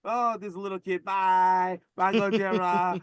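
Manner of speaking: put-on high, sweet child waving goodbye; sing-song kid saying bye; little kid voice. Voicing crying kid waving bye.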